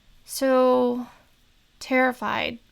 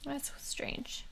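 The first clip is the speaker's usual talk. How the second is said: whispering; 3 semitones higher, 15 dB quieter than usual. Real, not acted. Player whispers aside.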